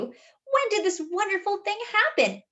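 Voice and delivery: ordinary delivery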